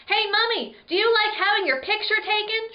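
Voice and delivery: high, loud cheeky yell. Acted. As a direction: shout it across the hall at the mummy. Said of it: "she yelled".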